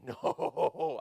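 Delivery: long wavering mock "no"; scared refusal. Dad imitating daughter refusing to raise hands, scared. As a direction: stretch it out like a frightened kid.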